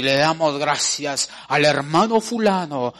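loud mock announcement; public acknowledgment. Preacher acting out thanking a brother publicly, loud. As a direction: announce it to the congregation.

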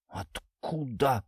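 slow, hushed, stunned question; shocked gasp. A narrator's reading. Ginzburg learning Natasha knows the secret, shocked. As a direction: draw the word out, shaken and soft. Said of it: "вскрикнул Гинзбург".

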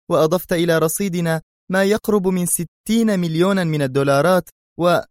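high female character voice, amazed; astonished exclamation. A narrator's reading. She reminds him of his successful mission, amazed and high-pitched. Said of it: "هتفت في دهشة".

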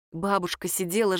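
ordinary delivery, nothing marked

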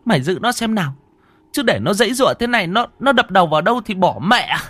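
put-on high, loud panicked old woman; urgent grandmother. Grandmother orders them to hold the boy, high and loud.

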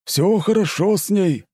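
high, slow forced-cheerful reply; nervous smiling manager. Acted. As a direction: grin through the lie.

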